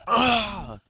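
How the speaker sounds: high, loud cry; acted yell. Game master voices trapped man, acted yell. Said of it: "you hear this guy yell".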